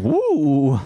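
long, high imitated owl hoot. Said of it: "There was an owl up there going".

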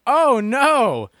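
high mock dismay. Real, not acted.

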